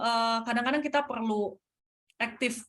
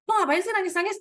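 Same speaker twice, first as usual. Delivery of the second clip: higher-pitched imitation of an exasperated parent; mock impatient scolding; 10 semitones higher, 4 dB louder, faster than usual. Parent to a child who cries over little things, mock impatient. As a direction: act out a dismissive parent's scolding question.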